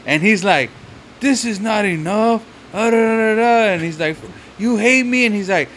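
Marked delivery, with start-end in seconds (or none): 1.2-3.7 higher-pitched imitation of a distraught man; mock protest, pitched up; lightly acted upset whining; retold tearful complaint. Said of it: "He's fucking crying". 4.6-5.2 higher imitation of a distraught man; lightly acted wounded complaint; retold tearful accusation. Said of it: "He's fucking crying".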